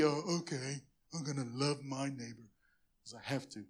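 low, grudging, dragged-out mock sigh voice; mopey and put-upon, loving only out of duty; mock reluctant duty. Pastor mocking a churchgoer's grudging love, mopey low voice. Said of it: "that Barney depressed voice".

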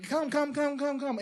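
high, sing-song come come; lightly hammed-up beckoning; mock welcoming call. Pastor mocking a church that only beckons people in, sing-song.

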